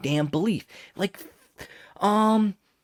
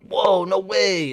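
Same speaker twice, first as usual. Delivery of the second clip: slow, higher, louder wide-eyed wonder; mock amazement; 6 semitones higher, 5 dB louder, slower than usual. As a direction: draw it out in pretend awe. Acts out movie characters' amazement.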